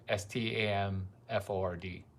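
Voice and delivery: normal talking voice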